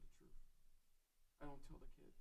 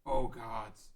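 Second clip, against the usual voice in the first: struck exclamation; 14 dB louder than usual. Acted. Sudden realization.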